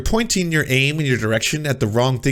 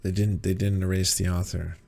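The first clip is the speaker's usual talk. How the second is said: muttered aside; 7 semitones lower, 8 dB quieter than usual. Real, not acted. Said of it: "muttered rebuttal to clip".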